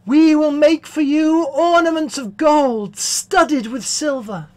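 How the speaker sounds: high, loud jubilant declamation; exultant chorus. Acted. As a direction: proclaim it like a rejoicing chorus.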